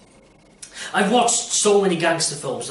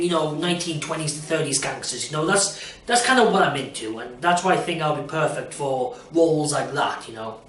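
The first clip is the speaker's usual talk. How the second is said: ordinary delivery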